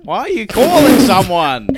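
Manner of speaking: high, loud suspicious demand; angry customer. Pretend. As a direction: snap at the flustered rep. Playing angry Apple customer.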